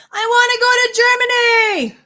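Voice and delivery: high, loud, pleading yell; Wolvie screaming. Retold or imitated. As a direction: scream it like a desperate cartoon hero. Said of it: "Wolvie is screaming".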